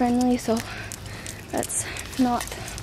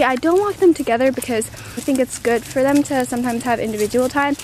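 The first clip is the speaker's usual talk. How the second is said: ordinary delivery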